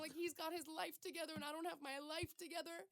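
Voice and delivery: high, wavering retold crying; mock tearful. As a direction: imitate her own teary rant, half-mocking. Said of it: "I was crying".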